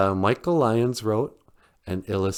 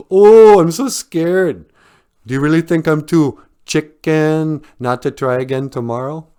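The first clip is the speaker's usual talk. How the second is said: mock scared; 6 semitones higher, 10 dB louder than usual. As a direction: feign fear to taunt the chicken. Fox mocks the angry chicken's threat.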